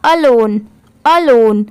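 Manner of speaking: अलोन blended, repeated after a short pause; whole-word pronunciation. Shown as a demonstration. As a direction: join अ and लोन into अलोन. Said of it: "पूरा मिला देंगे तो क्या हो जाएगा?". (अलोन मिलाकर साफ़ बोलना)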